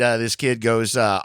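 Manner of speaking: ordinary talk, nothing marked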